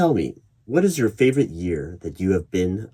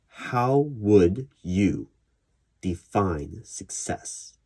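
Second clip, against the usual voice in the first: slow, each word spaced out; re-ask it slowly and carefully so it lands; slow deliberate rephrase; much slower than usual. Real, not acted. Interviewer correcting the question, slowly and deliberately. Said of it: "No, the".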